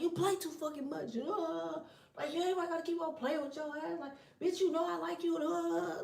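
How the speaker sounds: high, softened imitation of an angry rant; mock cussing out.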